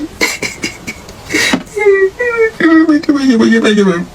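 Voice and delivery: high mock sobbing wail; fake wailing cry. Mock sobbing to win the man over.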